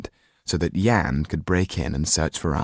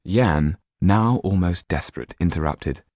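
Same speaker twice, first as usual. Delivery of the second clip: ordinary delivery, nothing marked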